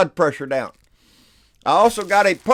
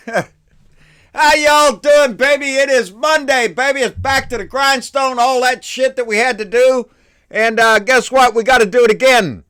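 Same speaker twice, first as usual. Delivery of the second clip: fired-up greeting; 7 semitones higher, 7 dB louder than usual. Real, not acted. Loud fired-up opening greeting.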